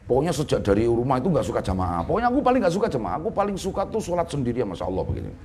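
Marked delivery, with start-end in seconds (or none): 2.1-4.7 high, fast imitation of a pupil; mock stubborn student. Teacher voices a stubborn student. (menirukan santri keras kepala, suara tinggi dan cepat)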